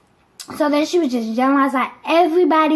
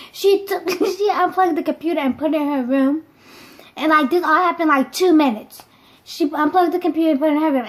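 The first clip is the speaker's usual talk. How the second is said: normal talking voice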